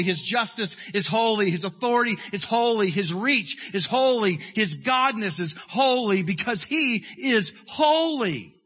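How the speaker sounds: steady rhythmic list, each ending on holy; preaching litany. Acted. Preacher listing God's holy traits. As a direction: build a chanting pulpit list.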